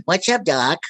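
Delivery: wisecracking cartoon rabbit voice; Bugs Bunny. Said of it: "you want Bugs Bunny?".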